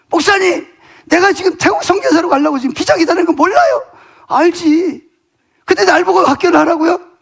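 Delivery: retold indignant protest, pitched high; angry outburst. Daughter's friend protesting to the pastor about running a school, angry outburst. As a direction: act out her flaring up at the pastor, voice high. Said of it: "갑자기 뭐 화를 팍 내는".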